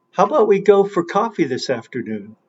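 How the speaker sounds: quick, run-together question; fast demo sentence. Shown as a demonstration. Teacher to students, fast stressed demo. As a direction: rattle it off to show stress. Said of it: "can you understand the following".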